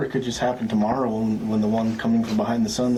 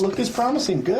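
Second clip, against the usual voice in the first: high, oracle-like announcement; magic eight ball voice; 11 semitones higher than usual. Acted. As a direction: intone it like a fortune toy.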